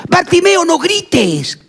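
long shush, then high-pitched scolding; mock reproof after a drawn-out "shh"; acted shushing. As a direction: hush a noisy beggar. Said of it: "vino alguien y le dijo".

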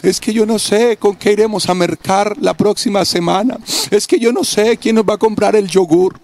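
voz levemente más aguda de niña angustiada; hija preocupada. Retold or imitated. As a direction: imitando con suavidad a una hija que teme por la comida. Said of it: "Y ella me dice". (hija que le cuenta al padre su preocupación, leve voz infantil)

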